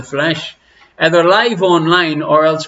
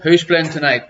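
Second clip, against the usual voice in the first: normal talking voice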